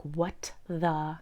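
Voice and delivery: confused viewer at sudden cold spring weather; baffled whisper. Retold or imitated. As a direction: mutter it under your breath in disbelief. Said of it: "and you're like".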